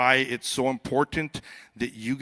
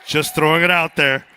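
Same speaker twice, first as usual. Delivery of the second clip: shouted jab; 3 semitones higher, 12 dB louder than usual. Real, not acted. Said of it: "I like to say that one".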